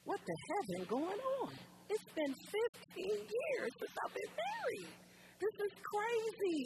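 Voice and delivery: high-pitched wailing prayer, acted out; tearful pleading. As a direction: cry out to God in a hammed-up, exasperated wail. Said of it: "with tears falling down my face".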